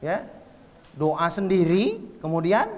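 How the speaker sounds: ordinary talk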